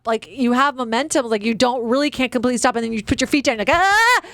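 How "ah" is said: sudden high yelp; a quick high startled cry. Retold or imitated. Feet slamming down on the hill, high yelp. Feet down while going downhill.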